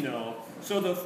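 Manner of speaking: ordinary talk, nothing marked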